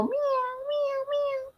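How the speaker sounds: put-on high cat meows like a toddler; imitated meow. Mother imitating her son's cat sounds, high meowing. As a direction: squeak out kitten meows. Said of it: "I'm gonna say these animal sounds".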